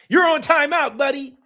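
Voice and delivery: usual speaking voice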